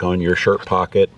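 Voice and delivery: ordinary delivery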